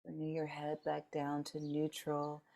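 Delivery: soft, unhurried meditation guide, long pauses between phrases; guided meditation. Real, not acted. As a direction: gently lead the group through noticing, leaving space after each phrase.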